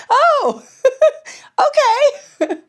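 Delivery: loud, very high-pitched oh, then giggles; laughing taken aback. Retold or imitated. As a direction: replay an awkward, startled reaction. Acts out awkward reaction to flattery.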